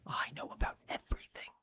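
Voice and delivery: unvoiced, fast secret whisper. Witch whispering her boast to the hero. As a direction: let her breathe it like a secret.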